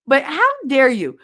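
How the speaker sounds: high, loud indignant outburst. Real, not acted. Viewer berating rich celebrities asking for donations, indignant. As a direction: tell them off with rising outrage.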